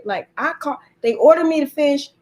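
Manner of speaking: ordinary talk, nothing marked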